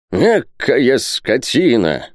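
high, drawn-out exasperated outburst; flaring up. Acted. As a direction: lose your temper, stretching the insult. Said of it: "вспылил Пальмирен Розет". (вспылив, протяжно и высоко)

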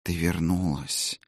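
slow, faint ghost whisper; a fading spirit, glad; ghostly whisper. Acted. Ghost speaks.

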